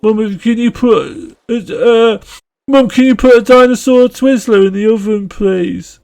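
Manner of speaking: put-on high, loud Brummie kid calling to mum; put-on Brummie whine, pitched right up; Brummie accent. Child pestering mum for oven food, loud Brummie accent. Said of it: "doing a Brummie accent asking for".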